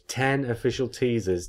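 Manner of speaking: ordinary talk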